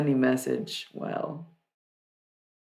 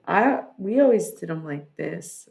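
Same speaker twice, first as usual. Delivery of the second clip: normal talking voice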